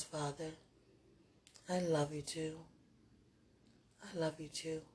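usual speaking voice

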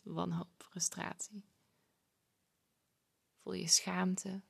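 slow, soft guided meditation voice; calm breathing guide, hushed and unhurried; soft spa whisper. Acted. Guided feeling check.

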